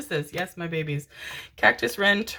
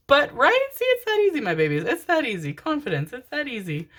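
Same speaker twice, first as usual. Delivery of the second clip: mock triumphant; 8 semitones higher than usual. Real, not acted. Mock triumph after shuffling.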